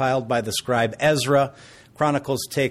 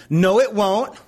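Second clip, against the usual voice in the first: put-on louder, higher stubborn child voice; three-year-old son refusing his dad, stubborn and loud; defiant toddler; 7 semitones higher, 5 dB louder, slower than usual. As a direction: play the bold little boy talking back.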